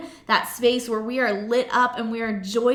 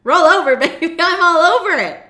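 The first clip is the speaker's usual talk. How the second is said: loud, laughing, thrilled reply; eager husband; 6 semitones higher, 10 dB louder than usual. Retold or imitated. Husband eagerly answering his wife in bed, loud and laughing. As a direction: jump at the offer with a laugh. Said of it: "He is gonna be like".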